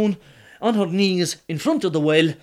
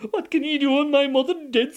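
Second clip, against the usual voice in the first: mock despair; 10 semitones higher than usual. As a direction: keep up the sorrow. Donal keeps up fake grief.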